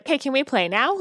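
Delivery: little son's voice. Retold or imitated. Son in story.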